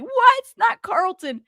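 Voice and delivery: high-pitched squeal of surprise; shocked disbelief. Acted. Commentator acting out her unexpected reaction to viewers, shocked. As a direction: jump up high on the first word, incredulous. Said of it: "I was not expecting that".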